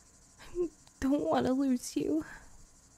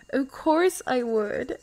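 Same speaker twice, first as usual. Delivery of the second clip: laughing; 5 semitones higher, slower than usual. Real, not acted. Teasing laugh at giant shoes.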